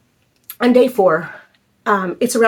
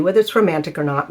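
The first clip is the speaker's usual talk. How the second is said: ordinary talk, nothing marked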